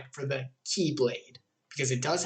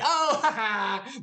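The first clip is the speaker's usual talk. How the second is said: drawn-out "oh" breaking into laughter; laughing realization; 4 semitones higher, much slower than usual. Real, not acted. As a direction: laugh as the joke lands. Gets the joke, laughs.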